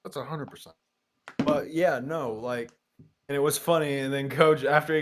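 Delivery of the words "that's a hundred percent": ordinary talk, nothing marked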